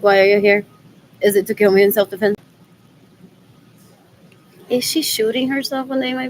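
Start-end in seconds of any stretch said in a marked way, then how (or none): 0.0-2.4 louder, lower, rushed alarmed question; loud mock lady. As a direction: blurt it like a suspicious clerk. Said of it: "She's like".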